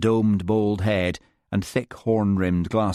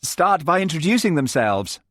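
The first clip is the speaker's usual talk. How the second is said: nervous laugh; 10 semitones higher, 3 dB louder than usual. A narrator's reading.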